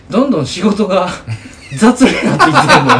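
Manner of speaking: laughing while confessing. Real, not acted. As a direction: breaks into laughter mid-sentence. Laughing at own sloppiness.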